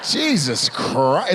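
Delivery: drawn out very slowly; slow, weary Jesus Christ; slow exasperated. Real, not acted. Reaction to the drink.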